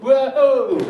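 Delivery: a loud, high, cheeky whoa; mock whoa shout. The nosy passer-by shouts a high loud whoa. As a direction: yell it to wind up the neighbour. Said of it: "for a laugh, I went".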